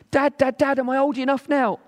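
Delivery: put-on high, eager child's begging voice; pleading little boy. Carlos begging his dad to dive, high child voice. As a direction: nag like an impatient small boy. Son asks again.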